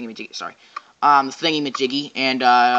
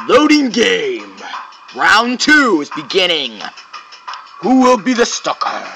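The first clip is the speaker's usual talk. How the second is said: slow, drawn-out announcer call; big, stretched-out announcer voice; game announcer; 2 semitones higher, slower than usual. Pretend. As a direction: announce the round with mock drama. Mimics game announcer reading round start.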